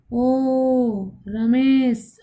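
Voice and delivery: ओ Ramesh with the ओ drawn out very slowly; calling from afar. Shown as a demonstration. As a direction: call ओ Ramesh across a distance. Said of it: "किसी व्यक्ति को दूर से बुलाना हो". (दूर खड़े रमेश को पुकारते हुए ओ को लंबा खींचकर)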